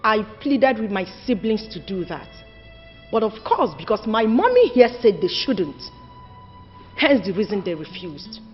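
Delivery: low, soft, measured account; respectful explanation. Acted. Daughter explaining custom to her uncle, subdued and respectful.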